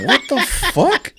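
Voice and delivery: high, slow baffled question; stunned disbelief, dragged out; baffled what the fuck. Retold or imitated. Host stunned by the hug, high slow disbelief. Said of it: "I'm looking like".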